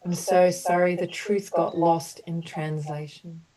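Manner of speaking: gentle, measured apology statements for the group; compassionate healing affirmations. Acted. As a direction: offer each sorry like a soothing balm. Leads group through healing apologies.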